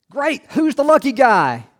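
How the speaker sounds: hammed-up jolly doctor asking; cheery doctor. Doctor in retold joke.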